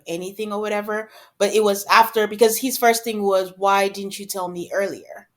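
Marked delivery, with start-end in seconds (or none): none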